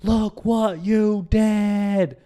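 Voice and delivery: high, loud, drawn-out scream; imitated screaming. Distraught girl screaming in the driver's ear, imitated scream. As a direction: scream it slowly as an accusation. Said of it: "starts screaming in my ear".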